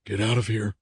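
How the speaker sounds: strained, hoarse repeated order. A narrator's reading. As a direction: force it out hoarsely. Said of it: "his voice hoarse and strained".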